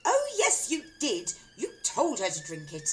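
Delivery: high, loud insistence; insistent wife. A narrator's reading. Wife insisting to husband. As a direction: insist he did it.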